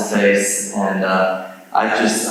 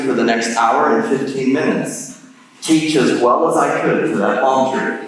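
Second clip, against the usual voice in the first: ordinary talk, nothing marked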